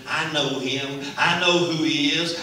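rising to a loud high cry; preaching at full force. Acted. Preacher voicing the healed man cheering for Jesus, loud and high. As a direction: build from a claim to a shout.

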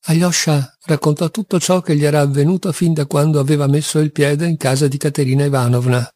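usual speaking voice

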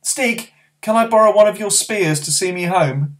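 put-on high, eager boy's voice; young boy calling to his friend; young boy Barney. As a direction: speak up as a small boy.

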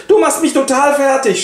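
high, dramatic wife's voice; Alkmene voice. Acted. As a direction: throw yourself into mock despair, pitched high. Alkmene exasperated. (hohe, theatralisch verzweifelte Frauenstimme)

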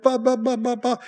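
high mock bleat of a bored sheep; playful baa baa sheep noise. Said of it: "a bunch of sheep who go".

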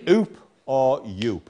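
oop and yoop with a long drawn oo; long vowel. Shown as a demonstration. Teacher showing pupils the long oo in oop and yoop, slowly. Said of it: "it has to be the long vowel sound".

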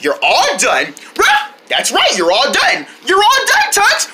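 ordinary talk, nothing marked

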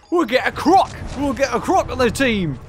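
put-on hammy Australian accent; mock Aussie twang. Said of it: "that's my amazing Australian accent".

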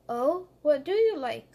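nervously asking the bear; worried. Acted. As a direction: ask it with a touch of nerves. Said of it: "said Squirrel in a worried voice".

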